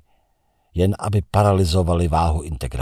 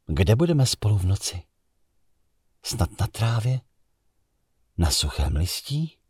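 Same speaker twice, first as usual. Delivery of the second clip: a lightly voiced woman, higher and hushed; dreamy woman; 7 semitones higher than usual. The woman to the narrator, lightly voiced and dreamy.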